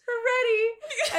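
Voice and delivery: high, drawn-out, on the edge of laughter; slow, high, laugh-choked call; imitated giggly announcement. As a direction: mimic trying to order through giggles. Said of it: "both laughing too hard".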